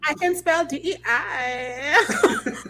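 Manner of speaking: hammed-up basic girl.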